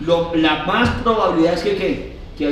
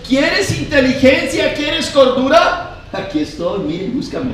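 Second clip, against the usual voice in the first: higher, slow inviting call; voice of wisdom; 7 semitones higher, 4 dB louder than usual. Acted. Preacher voicing Wisdom inviting the youth, slow and lifted. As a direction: call the young to seek wisdom. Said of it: "la Biblia está diciendo".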